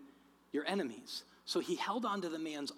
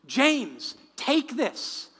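loud, raised-pitch urgent call; 6 semitones higher, 9 dB louder, slower than usual. Retold or imitated. Victim hands departing mugger a New Testament, loud calling out. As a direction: call after someone who is leaving.

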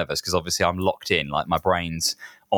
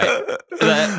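diva voice; 13 semitones higher, 4 dB louder than usual. Pretend. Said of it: "the diva".

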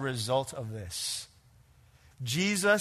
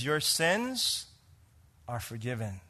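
tender; 4 semitones lower, 5 dB quieter, slower than usual. Acted. Jesus speaks to her personally.

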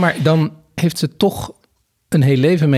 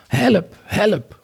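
calling for help; 8 semitones higher than usual. A narrator's reading. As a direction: call out high, as if from afar. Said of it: "hoorden ze iemand roepen". (hoog om hulp roepen)

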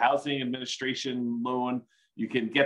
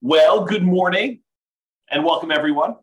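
big greeting; 5 semitones higher, 8 dB louder than usual. Real, not acted. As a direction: greet the room with energy. Opening greeting to webinar.

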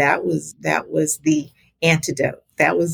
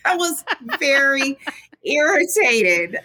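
laughing; 8 semitones higher than usual. Real, not acted. Repeats it, laughing.

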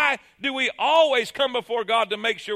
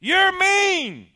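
put-on high, loud childish yell; boy shouting at the echo; angry child; 9 semitones higher, 7 dB louder than usual. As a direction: pout and yell it. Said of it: "he's getting mad".

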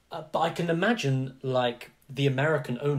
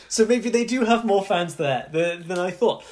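laughing; 6 semitones higher, 6 dB louder than usual. Real, not acted. Still amused after laugh.

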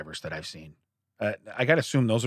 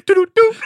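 high, loud sing-song "do-do-do" through a laugh; mock magic sound; 10 dB louder than usual. Imitating the wizard's thumb trick noise, laughing. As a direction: toot a silly magic jingle. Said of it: "He makes a noise".

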